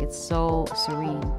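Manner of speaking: usual speaking voice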